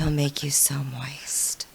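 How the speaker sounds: breathy whisper, slow. Pretend. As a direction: whisper it suggestively. Joking teasing line.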